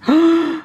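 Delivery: high, loud gasp; big sharp intake of surprise; shocked gasp. Acted. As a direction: gasp in amazement. Reacting to double decker news.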